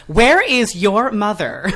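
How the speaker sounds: hammed-up scolding manager, laughing; stern manager. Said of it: "the manager's like".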